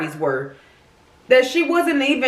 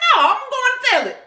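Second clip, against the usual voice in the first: put-on high, fast childish threat; high-pitched kid whine, quick and defiant; little girl tattling. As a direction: squeak it out like a seven-year-old about to tell on you. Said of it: "I'm like".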